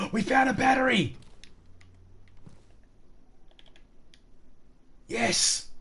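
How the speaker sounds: high, thrilled action-movie cheer; excited movie heroine. Retold or imitated. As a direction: squeal it like a movie hero finding treasure. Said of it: "Him and Halle Berry doing".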